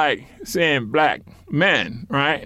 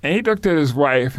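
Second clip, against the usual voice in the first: usual speaking voice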